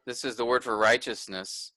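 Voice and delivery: normal talking voice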